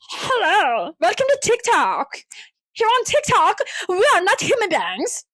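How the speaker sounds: high, swooping fake influencer; non-human influencer accent. Shown as a demonstration. Podcaster demonstrating her made-up accent, non-human influencer. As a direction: demo an absurd brain-rot voice. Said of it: "my accent of someone who's not a real human being".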